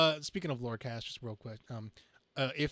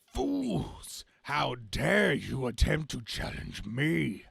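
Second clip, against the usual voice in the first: villain roar; 3 dB louder, much slower than usual. Acted. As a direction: roar down at the heroes. Villain reads his lines.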